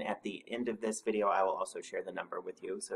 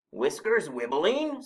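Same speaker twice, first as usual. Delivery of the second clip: higher, louder slow echo; snorted retort; 7 semitones higher, 7 dB louder, slower than usual. A narrator's reading. Snorklum answering Mole, slow snorted disbelief. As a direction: throw it back as an affronted question. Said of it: "snorted the Snorklum".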